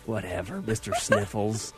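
low, quiet, unimpressed wife voice; dismissive wife. Retold or imitated. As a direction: brush him off flatly as his wife. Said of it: "She's like".

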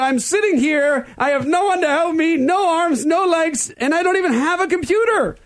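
very high, hammed-up plea; mock lament. Mock plea of helpless victim.

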